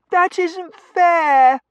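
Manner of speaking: very high, loud, drawn-out whine; piercing childish wail; child's wail. Acted. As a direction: wail the protest like a thwarted little girl. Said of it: "Eglantine wailed".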